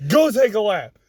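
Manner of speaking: normal talking voice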